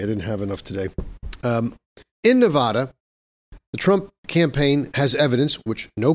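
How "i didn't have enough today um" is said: talking between sips of coffee; just after a sip of coffee; while sipping a drink. Real, not acted. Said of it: "excuse me while I sip my coffee".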